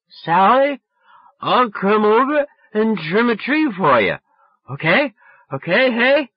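put-on very high, loud drunk pleading; drunken hollering. A narrator's reading. Drunk boy pressing Sally about the tree, hollering. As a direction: holler sloppily for an answer. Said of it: "Boy, was I drunk".

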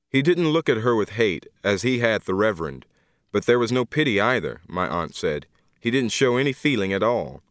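plain reading voice

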